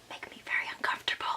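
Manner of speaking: fast, hushed whisper; fast confiding whisper. Real, not acted. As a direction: whisper it quickly, like a confession. Confiding about bathroom looks.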